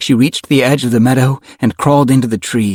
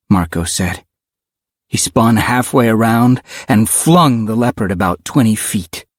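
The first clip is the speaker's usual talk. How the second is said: plain reading voice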